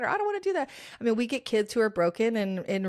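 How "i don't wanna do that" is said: put-on high whiny teen voice, pitched way up; mock whiny student refusal, high-pitched; whiny kid. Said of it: "kids are like".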